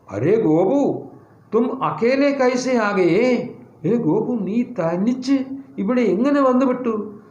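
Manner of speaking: surprised questioning, pitch lifted. Acted. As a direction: act amazed at finding a child alone. Uncle finds lost child alone.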